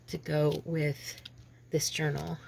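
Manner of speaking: ordinary talk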